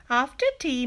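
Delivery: plain reading voice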